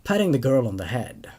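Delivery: low, even horror narration; story narration. A narrator's reading. Narrator telling the zombie outbreak horror story, in low even narration. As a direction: read it like a grim bedtime tale.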